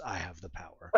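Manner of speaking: low, hushed mock scream; strained battle cry held right down; toy sword's battle cry. Dad imitating the He-Man sword's recorded scream, low mock scream. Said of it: "and it screams".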